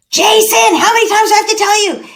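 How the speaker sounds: high-pitched mock yell at a child; exasperated parent yelling. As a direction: yell it like a parent who's been ignored. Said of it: "They tune us out until we're yelling".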